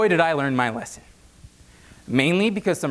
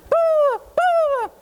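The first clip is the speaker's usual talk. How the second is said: very high yammering bah bah; mom's ranting babble; 4 dB louder, slower than usual. Retold or imitated. Frantic mother ranting at her son, very high babble.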